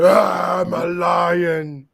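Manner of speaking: loud, drawn-out mock roar; growling, over-the-top wrestler bellow; Veer. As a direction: roar it slowly, hammed up as a wrestler. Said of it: "He said".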